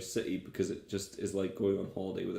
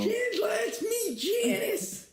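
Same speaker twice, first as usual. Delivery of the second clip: put-on old man calling Janice; 3 dB louder than usual. Co-host playing a cranky old man yelling for Janice, very high screech.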